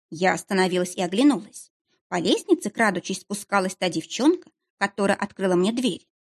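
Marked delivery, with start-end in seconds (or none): none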